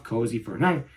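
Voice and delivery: usual speaking voice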